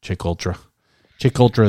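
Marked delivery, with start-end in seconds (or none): 1.2-1.7 mild Boston accent imitation. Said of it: "as [name] says in his Boston accent".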